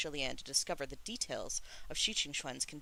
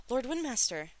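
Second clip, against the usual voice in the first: high, raised cry of alarm; alarmed exclamation; 9 semitones higher, 5 dB louder than usual. A narrator's reading. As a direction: call out in shock. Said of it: "Shi Lian exclaimed".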